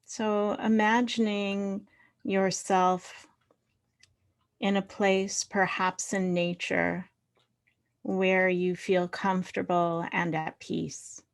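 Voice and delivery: unhurried meditation voice with long pauses; guided meditation. Real, not acted. Leader inviting the group to find body and earth, guided meditation. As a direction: settle them gently into body and breath.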